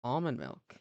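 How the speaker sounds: ordinary delivery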